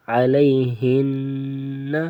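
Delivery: علیہن very slow, the final n held in the nose; nasal ghunna. Shown as a demonstration. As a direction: hold the n of علیہن as a long nasal hum. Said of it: "یہاں پہ غنہ ہوگا". (علیہن میں نون پر غنہ لمبا)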